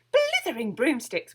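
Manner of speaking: loud, high witch's exclamation; excited exclamation. A narrator's reading. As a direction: burst out in delight. Winnie's exclamation at caterpillar.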